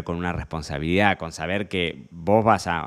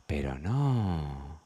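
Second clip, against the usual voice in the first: desaprobación burlona; 5 semitones lower, 9 dB quieter, much slower than usual. Real, not acted. Desaprueba la actitud del bebedor.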